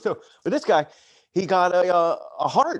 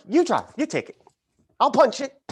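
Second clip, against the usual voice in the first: higher-pitched mock insistence; acted urgent plea; 9 semitones higher, 4 dB louder than usual. Telling God on the way out of the theater, acted insistence. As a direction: replay a bargain with God, pitched up.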